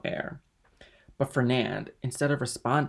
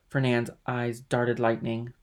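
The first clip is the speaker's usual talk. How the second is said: plain reading voice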